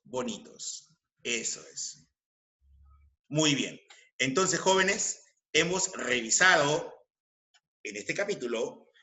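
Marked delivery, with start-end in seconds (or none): none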